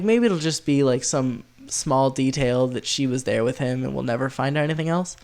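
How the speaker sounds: normal talking voice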